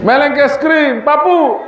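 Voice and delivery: high-pitched mock child's whine; anak merengek. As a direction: plead like a child begging grandma for a treat. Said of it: "Apa pemilik nangis wah?". (rengekan anak kecil bernada tinggi)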